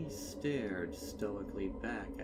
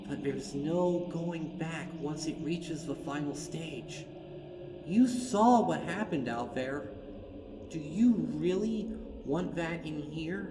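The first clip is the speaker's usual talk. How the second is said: higher and louder, pressing; urgent Twilight; 8 semitones higher, 8 dB louder than usual. Acted. Twilight warning Fluttershy, higher, louder and urgent. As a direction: drive the warning home.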